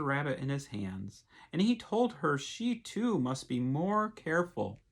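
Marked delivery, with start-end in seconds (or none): none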